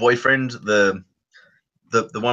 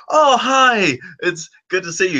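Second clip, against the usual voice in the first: much higher, fake-cheery hello; awkward greeting; 7 semitones higher than usual. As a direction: caught out, forced cheerful hi. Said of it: "and the guy is like".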